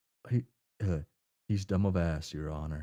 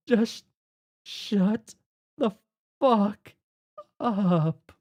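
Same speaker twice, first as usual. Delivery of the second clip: words split apart, higher; furious player character telling Jake off; yelling; 6 semitones higher, much slower than usual. Acted.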